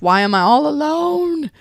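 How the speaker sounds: hammed-up lonely lament; mock self-pity. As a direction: whine it high like a lonely sulking child. Querent's lonely self-pity quote.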